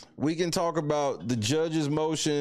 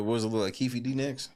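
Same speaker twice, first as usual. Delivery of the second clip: ordinary talk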